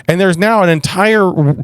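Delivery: normal talking voice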